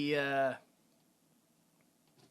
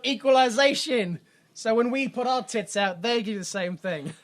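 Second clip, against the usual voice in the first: put-on English accent, higher pitched; 4 semitones higher, 5 dB louder than usual. Spice Girl arguing for equalization, mock English accent. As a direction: declaim it in a hammed-up English accent. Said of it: "in an English accent".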